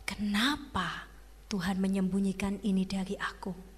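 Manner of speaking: soft, secretive questioning whisper; whispered doubt. Acted. Eve doubts God under her breath, whispering. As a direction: wonder in a hush why it's forbidden.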